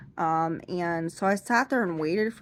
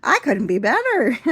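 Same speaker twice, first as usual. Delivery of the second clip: high, loud sarcastic denial through a laugh; mock-sarcastic "fine"; 11 semitones higher, 7 dB louder than usual. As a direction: insist you're fine while clearly in pain.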